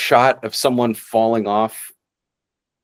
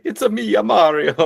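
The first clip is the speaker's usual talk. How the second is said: Mario; 9 semitones higher than usual. Retold or imitated. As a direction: do a mock Italian accent, pitched up. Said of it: "It's basically like".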